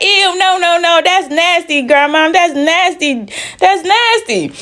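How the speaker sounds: put-on high childish ew, that's nasty; children telling grandma it's nasty; disgusted kids. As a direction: squeal it like grossed-out little kids. Kids disgusted at kissing question.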